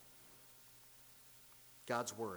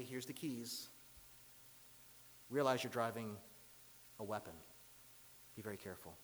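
low, quiet fatherly warning; hushed, low, serious dad voice; solemn dad; 5 semitones lower, 8 dB quieter than usual. Retold or imitated. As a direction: caution a new driver gravely, with long pauses. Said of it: "There was a solemn charge".

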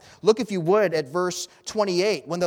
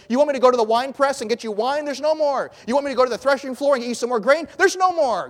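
higher, louder, fast exasperated outcry; shouting from the wall; 9 semitones higher, 9 dB louder, faster than usual. Acted. King shouts down from the wall at the crowd, exasperated. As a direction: yell it so the whole city hears. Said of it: "He says it from the wall where everyone can hear".

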